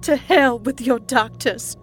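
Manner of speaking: slow, high, loud scorn; zealot's contemptuous sneer. A narrator's reading. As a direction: spit scorn in drawn-out words. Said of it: "Maureen sneered".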